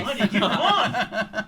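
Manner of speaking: laughing. Real, not acted. Laughing protest at the table.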